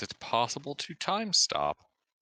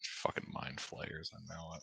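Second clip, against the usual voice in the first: muttered aside; 3 semitones lower, 14 dB quieter than usual. Real, not acted. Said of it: "muttered aside".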